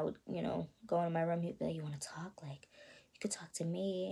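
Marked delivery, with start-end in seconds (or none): 1.8-4.1 put-on whispered, coaxing the girl to open up; uncle's soft whispered invitation; hushed creepy uncle. Creepy uncle voiced in story.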